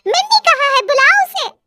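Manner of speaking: high, shrill-free neighbour auntie voice; neighbour woman. A narrator's reading. (पड़ोसन की ऊँची आवाज़)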